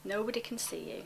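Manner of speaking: ordinary talk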